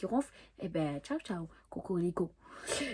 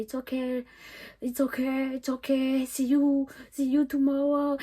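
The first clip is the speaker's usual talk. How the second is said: put-on high, drawn-out English with a French accent; 5 semitones higher, 3 dB louder than usual. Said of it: "Non, mais avec mon anglais". (anglais à l'accent français, haut et traînant)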